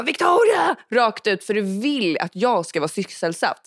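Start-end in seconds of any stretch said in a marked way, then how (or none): 0.0-0.8 high, sudden mock yell of a name; retold yell, pitched well up; imitated shout. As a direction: imitate someone shouting for you. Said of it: "då skriker du ändå bara".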